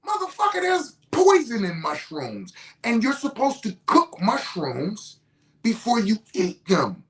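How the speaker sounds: high-pitched acted argument voice; heated lecture. Opponent explaining the danger of raw mushrooms, heated lecture. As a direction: school him hard, replaying the opponent.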